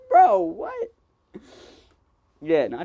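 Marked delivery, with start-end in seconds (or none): 0.1-0.9 incredulous high bro what. Retold or imitated. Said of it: "They were like".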